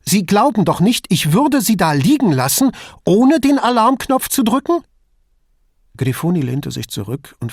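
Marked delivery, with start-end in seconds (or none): 0.0-2.7 high, loud, rattled appeal; insecure, agitated protest; unsettled. A narrator's reading. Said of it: "Verunsichert von ihrer strengen Miene". 3.0-4.8 very high, loud, rattled question; incredulous, anxious appeal; unsettled question. A narrator's reading. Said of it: "Verunsichert von ihrer strengen Miene".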